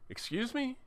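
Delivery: indignant. Retold or imitated. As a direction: push back in disbelief at his nerve. Irritated reply to rude kid.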